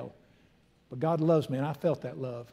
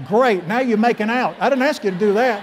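laughing, louder and higher, teasing the crowd; loud laughing mock scolding; 6 semitones higher, 10 dB louder than usual. Real, not acted. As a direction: tease them through a laugh.

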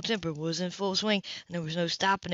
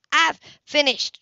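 higher, louder, slow curt reply; curt woman; 7 semitones higher, 6 dB louder, slower than usual. Acted. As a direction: cut him off firmly. Grace cuts him off.

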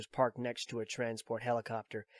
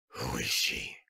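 put-on much lower, a weary old soldier; Snake's voice; 12 semitones lower, slower than usual. Old soldier explains his pursuit to Drebin, low and weary. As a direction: drop into the tired veteran's low voice.

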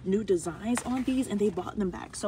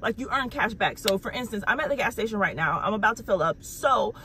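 lively, emphatic sponsor pitch; upbeat ad read; 2 semitones higher, 9 dB louder than usual. Real, not acted. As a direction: sell it with real enthusiasm. Sponsor read with contest announcement.

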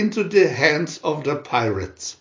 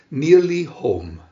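plain reading voice

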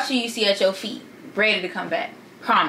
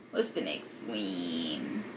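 slow near-whisper aside; hushed, slow muttered question; 15 dB quieter, slower than usual. Real, not acted. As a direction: murmur it to yourself, slowly. Muttered aside reading her list.

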